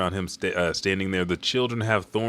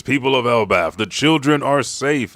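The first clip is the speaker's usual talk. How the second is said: loud, raised announcement; acted proclamation; 6 semitones higher, 7 dB louder than usual. Chief telling his people children are safe, proclaiming. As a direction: call it out to a whole crowd.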